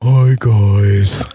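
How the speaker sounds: Juan impression. Cohost greets the listeners in a low, loud Juan impression. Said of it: "that's my Juan impression".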